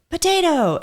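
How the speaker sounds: high, loud, bright "Potato" for a great day; excited potato. Retold or imitated. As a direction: sing out "Potato" like the best day ever. Patient's happy potato.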